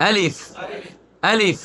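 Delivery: আলিফ said short, falling at the end; no elongation. Shown as a demonstration. Teacher drills আলিফ for repetition, no elongation. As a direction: model আলিফ with no stretched vowel. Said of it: "কোনো মাদ হবে না".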